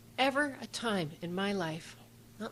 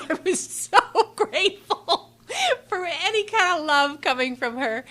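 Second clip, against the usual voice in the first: laughing gratefully; 10 semitones higher, 10 dB louder than usual. Real, not acted. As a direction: laugh it out, overcome with thanks. Grateful memory of the stranger's kindness.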